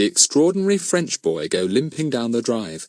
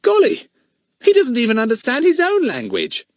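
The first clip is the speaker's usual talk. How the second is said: put-on boy's voice, much higher and louder, in disgust; 4 dB louder than usual. A narrator's reading. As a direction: scoff at the boy. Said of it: "said Pip in disgust".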